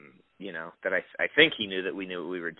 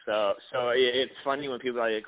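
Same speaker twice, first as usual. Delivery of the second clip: normal talking voice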